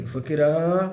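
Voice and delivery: long call across the yard. Acted. Mother calls her missing son from the door, drawn-out call. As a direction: call the boy's name from the doorway.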